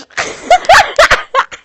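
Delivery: very high, loud laugh; shrieking laugh. Real, not acted. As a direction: burst into a screaming laugh.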